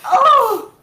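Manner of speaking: high, loud laughing cry; laughing exclamation. Real, not acted. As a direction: burst out laughing in delight. Overwhelmed by loving replies.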